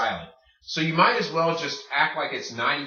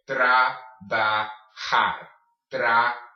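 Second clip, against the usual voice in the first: trabajar broken into separate syllables with pauses; slow syllables; 4 dB louder, faster than usual. Shown as a demonstration. Teacher drills the Spanish word for learners, slow trabajar. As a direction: sound out each syllable of trabajar, j like H. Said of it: "saying the Spanish word slowly".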